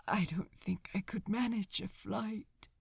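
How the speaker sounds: faint whispered admission; exhausted angel whispering to the demon. A narrator's reading. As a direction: breathe it out in a whisper. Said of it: "he whispered".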